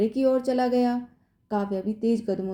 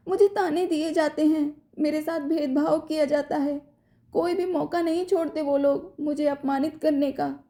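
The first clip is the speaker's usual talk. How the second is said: crying; 7 semitones higher than usual. A narrator's reading. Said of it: "Kavya ने रोते हुए कहा".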